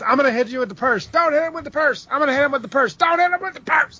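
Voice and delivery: high, fast mock dialogue. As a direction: ham up the silly back-and-forth. Mocking the storyline's dialogue.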